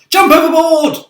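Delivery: high, loud command; frantic shout. A narrator's reading. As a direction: bellow it in panic. Characters panic at attacker.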